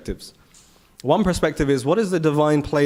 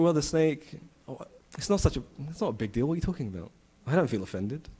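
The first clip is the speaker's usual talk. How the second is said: unbothered dismissal; 7 dB quieter than usual. Retold or imitated. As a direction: play it down quietly, as no big deal. Acting the father shrugging off the offence.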